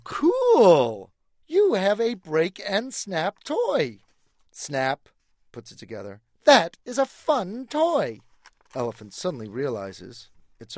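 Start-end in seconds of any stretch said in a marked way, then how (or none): 0.0-4.0 higher, loud, slow and cheerful; a delighted little squirrel; enthusiastic squirrel. Acted. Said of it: "in comes a little squirrel". 6.4-8.2 higher, loud, slow and cheerful; a delighted little squirrel; enthusiastic squirrel. Acted. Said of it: "a little squirrel".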